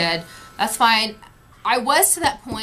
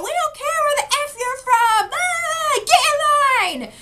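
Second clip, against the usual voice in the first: high-pitched mock angry shouting; hammed-up holler, stretched at the end; imitated crowd screaming. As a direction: yell like a crowd about to riot. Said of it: "screaming and yelling".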